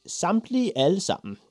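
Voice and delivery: put-on high, loud boy's voice; eager and very sure; proud insistence. A narrator's reading. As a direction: insist on it triumphantly. Boy proudly insists on all wishes.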